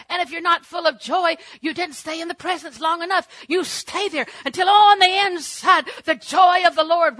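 loud, high preaching at full force; preacher's shout. Real, not acted. Preacher driving home that she stayed with the Lord, shouting.

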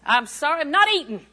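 imagined Eve standing her ground; firm refusal. Acted. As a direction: play a defiant, emphatic no. Acts out Eve refusing the devil.

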